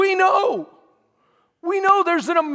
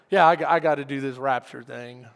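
low, dejected mock reply; reluctant, low-energy mumbled excuse; unenthused reluctance; 11 semitones lower than usual. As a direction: play it glum, like the rapture is a chore. Acting out reluctant response to rapture.

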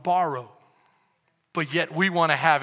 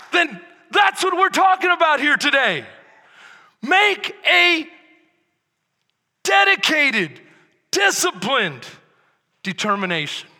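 loud, higher, driving sermon; full-force preaching; 10 semitones higher, 11 dB louder than usual. Acted. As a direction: preach it hard to the congregation.

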